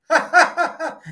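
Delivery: high, loud, stiff forced "ha ha ha"; mock fake laugh. Podcast host imitating the Beta unit's forced laugh, mock. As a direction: imitate a robot trying to laugh on cue. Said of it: "And he goes".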